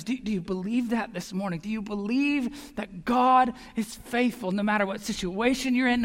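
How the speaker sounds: ordinary talk, nothing marked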